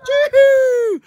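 very high celebratory whoop; excited whoop. Real, not acted. Host cheers on both teams before kickoff, high whoop. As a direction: let out a high, piercing whoop.

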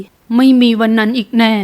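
ordinary delivery, nothing marked

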